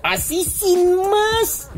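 very high, drawn-out incredulous cry; mock amazement. Host reacting to the battery exploding, mock amazement. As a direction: stretch it way up in mock disbelief.

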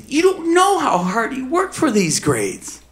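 high, slow, pointed retort; indignant. Retold or imitated. Father telling the judge off about the grades, indignant. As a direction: defend your son firmly.

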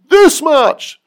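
big, high, loud exclamation; excited. Acted. Lover showing how much, high and loud. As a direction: fling the words out with arms wide.

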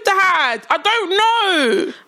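lightly voiced pleading child; whiny child.

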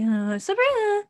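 high, slow, loud mock-cheery name; put-on bubbly self. Mocking her own work persona, high and cheery. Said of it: "I don't need to be".

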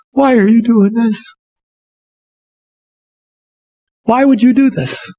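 a retold teacher near tears, asking why; crying woman. Pastor voicing the teacher who begins to cry, tearful. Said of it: "She starts to cry".

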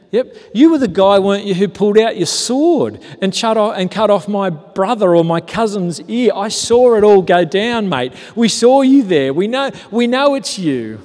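corner him with it, quick and pushy; accusing bystander. Retold or imitated.

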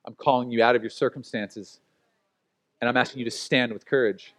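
low, soft, solemn divine voice; voice of God. Acted. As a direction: speak as God, quietly and gravely. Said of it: "God said".

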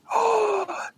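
sharp gasp; a sudden intake of breath. Real, not acted. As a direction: gasps in surprise. Sharp gasp before big reveal.

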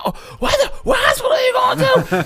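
very high mock shrieking; frantic mom. Mother hears her son crashed, frantic high screech. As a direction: squeal it in exaggerated panic.

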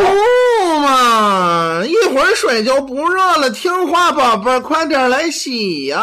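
whiny coaxing wife. Retold or imitated. Wife pouting to get her husband into the bath, high. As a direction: whine like a spoiled sweetheart. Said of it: "他媳妇儿可能没想到".

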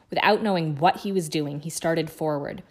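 plain reading voice

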